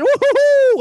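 high loud whooping cheer; hype cheer woo. Acted. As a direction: whoop like a crowd. Hype cheer after the intro.

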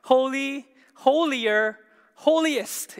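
"holy, holier, holiest" in separate loud beats; emphatic comparison. Shown as a demonstration. Preacher showing the congregation the English superlative, emphatic. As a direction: start high on HOLY, step down through HOLIER and HOLIEST. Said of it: "we would say what?".

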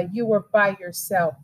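usual speaking voice

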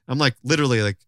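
ordinary talk, nothing marked

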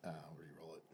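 voiceless under-breath aside; whispered aside. Real, not acted. Player re-rolling dice, whispering to himself. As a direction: mutter it to yourself.

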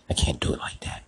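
soft whispered refusal. Acted. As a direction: whisper it to yourself. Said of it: "repeated as a whisper".

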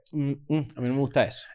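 soft, low murmured "no me gusta"; mock prim objection, hushed and low; quiet disapproval. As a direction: mumble your distaste under your breath. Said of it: "Leo dice como que".

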